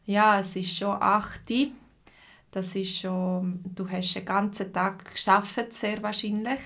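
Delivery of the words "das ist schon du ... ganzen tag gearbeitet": ordinary delivery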